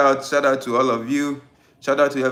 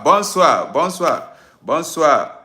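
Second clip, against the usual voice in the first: higher, louder bonsoir called out gleefully; excited bonsoir; 5 semitones higher, 6 dB louder, slower than usual. Real, not acted. Greeting friends in France on the livestream, excited and loud. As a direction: belt out bonsoir with delight. Greeting French viewers playfully.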